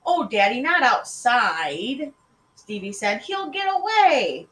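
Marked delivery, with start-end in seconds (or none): 0.1-2.1 loud, drawn-out whine; higher, protesting little boy; pleading child. A narrator's reading. Child pleading with stern father. 3.2-4.5 high, loud, drawn-out whine; little boy's anxious protest; pleading child. A narrator's reading. Child pleading to keep frog.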